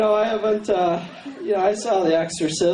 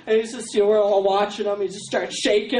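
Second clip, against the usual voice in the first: ordinary talk, nothing marked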